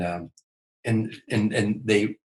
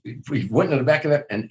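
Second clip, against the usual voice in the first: usual speaking voice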